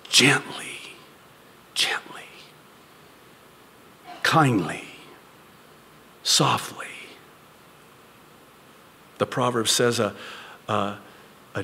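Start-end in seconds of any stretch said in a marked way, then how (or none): 0.1-6.9 hushed, near-whispered words; gently. Shown as a demonstration. Models gentle restoring while reading.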